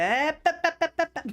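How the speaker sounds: high, loud pep noises; mock chirping. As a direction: chirp like the meme. Imitates meme sound.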